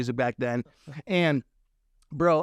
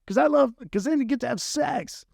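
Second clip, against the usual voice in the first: stage pitch; 11 semitones higher than usual. Retold or imitated. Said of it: "I'm like".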